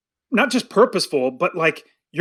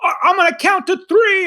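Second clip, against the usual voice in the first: high-pitched mock threat; yelling parent; 12 semitones higher than usual. Parent warning kids in a grocery store, yelling. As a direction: imitate a fed-up parent yelling a countdown warning. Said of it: "just yelling".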